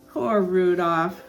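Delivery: ordinary delivery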